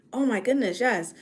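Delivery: normal talking voice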